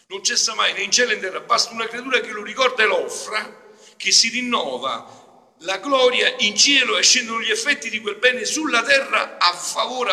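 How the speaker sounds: loud, high, impassioned sermon; fervent preaching. Real, not acted. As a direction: preach it out to the congregation. Preaching at full force. (predica appassionata)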